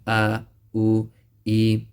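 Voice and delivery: "a, u, e" with a plain glottal stop, no squeeze; wrong hamza version. Shown as a demonstration. Teacher showing students the common mistake. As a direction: say it lazily as a hamza. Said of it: "pronouncing as a hamza".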